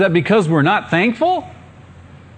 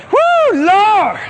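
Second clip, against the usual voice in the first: much higher and louder joyous shout of thanks; excited loud praise; 4 dB louder than usual. Retold or imitated. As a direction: shout it out like a man just healed. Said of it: "A loud voice".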